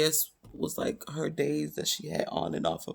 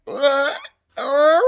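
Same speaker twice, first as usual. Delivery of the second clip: louder, higher tearful outburst; crying out in disbelief at the news; 6 semitones higher, 9 dB louder, much slower than usual. Retold or imitated. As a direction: wail it through tears. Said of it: "boohoo crying".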